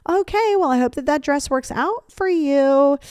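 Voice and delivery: high, slow sing-song false politeness; passive aggressive. Shown as a demonstration. Friend giving a backhanded compliment on a dress, passive aggressive. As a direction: drag out the good wishes with veiled disapproval. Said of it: "Backhanded compliments are a massive".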